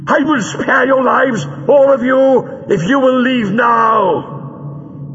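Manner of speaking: high villain shouting across a room; high-pitched shout, drawn out at the end. A narrator's reading. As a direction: shout terms at cornered enemies. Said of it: "Greel shouted from his hiding place".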